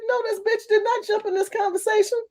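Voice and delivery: quiet high-voiced indignation; high-pitched disbelief. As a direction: pitch it way up, held back in volume. Acting out indignant disbelief at interrupter.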